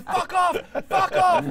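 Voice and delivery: very high, loud repeated shout; screaming. Retold or imitated. What neighbours heard as man chased woman, screaming. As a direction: yell it like a woman being chased.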